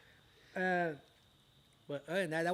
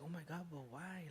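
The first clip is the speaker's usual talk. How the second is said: casual, hushed quote; 13 dB quieter than usual. Retold or imitated.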